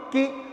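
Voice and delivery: کی with a short, unstretched i; short vowel. Shown as a demonstration. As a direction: cut کی short, no lengthening. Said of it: "خالی حرکت دی ہے". (کی کو بغیر کھینچے)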